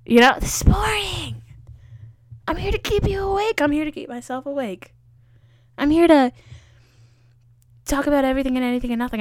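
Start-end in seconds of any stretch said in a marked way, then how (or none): 2.5-9.2 energetic. Real, not acted.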